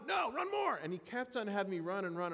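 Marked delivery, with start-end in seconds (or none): 0.1-0.8 stern coach refusal. Retold or imitated. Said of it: "Is that enough?".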